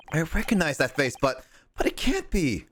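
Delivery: a hint of a shocked heroine; high young woman voice. Heroine voice again.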